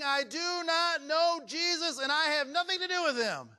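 cursing denial. Retold or imitated. Preacher voicing Peter cursing his denial of Jesus, high heated shout. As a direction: fling the denial out high and hot. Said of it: "a preacher who's cussing and swearing and saying".

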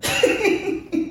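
high airy burst of laughter; sudden bright laugh. Real, not acted. Laughing at co-host's remark.

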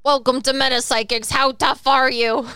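mock tough greeter. Parodying the Salty Spittoon door, loud mock challenge. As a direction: challenge them loudly, stretching the last question.

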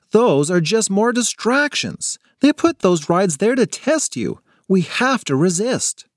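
lightly voiced girl, stern; serious girl. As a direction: warn them not to fall for it.

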